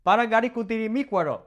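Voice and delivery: rapid-fire Spanish tongue twister; fast showy run-through. Shown as a demonstration. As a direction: rattle it off quickly. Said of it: "Can you say these ten impossible tongue twisters".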